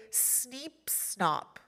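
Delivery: nose pinched shut; blocked nose. Shown as a demonstration. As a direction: say it holding your nose. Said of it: "plug your nose and say".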